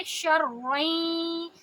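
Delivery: شرعیں slow, the ending nasalised; Quran recitation. Shown as a demonstration. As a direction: chant شرعیں with a held nasal. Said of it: "شین کو را سے ملائیں گے". (شرعیں غنہ کے ساتھ لمبا)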